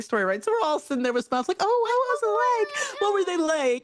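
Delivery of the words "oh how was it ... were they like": high, bright mock-eager questions; sugary mock curiosity; mock sweet eager voice. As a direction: chirp it like an excited fan.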